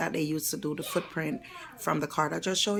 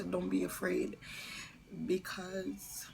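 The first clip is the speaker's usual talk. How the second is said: ordinary talk, nothing marked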